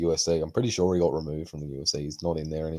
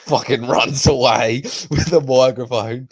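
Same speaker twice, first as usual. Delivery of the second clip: ordinary talk, nothing marked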